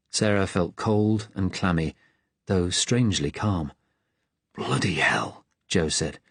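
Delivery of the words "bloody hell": hushed curse under his breath; whisper. Acted. Hiding boy whispers to the girls in relief.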